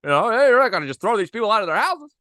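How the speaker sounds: high-pitched, hurried, stammering protest; movie hero impression. Podcaster playing the hero standing up to Mister Potter, flustered impression.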